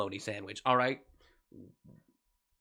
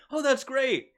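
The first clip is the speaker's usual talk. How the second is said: chirpy delight; 12 semitones higher, 4 dB louder, slower than usual. Retold or imitated. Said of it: "and I went".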